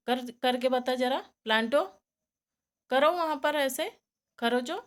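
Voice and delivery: loud, high urging; calling out to pet. Real, not acted. Owner urging Planto to show the scratching, loud high call. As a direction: egg the rabbit on loudly.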